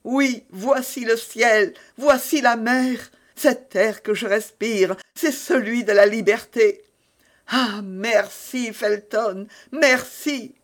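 high, drawn-out rapture; exclaiming in relief. A narrator's reading. Milady tasting freedom and thanking Felton, elated exclamation. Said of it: "s'écria-t-elle".

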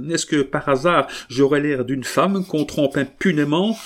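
furious defiance. A narrator's reading. Wife defiantly admits spying.

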